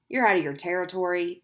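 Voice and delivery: usual speaking voice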